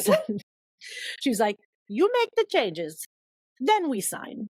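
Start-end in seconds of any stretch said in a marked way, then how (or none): 1.9-4.5 slow, higher Ecuadorian-accented imitation; bad Ecuadorian accent. Agent setting terms for her author, Ecuadorian accent. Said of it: "do a horrible job of her accent".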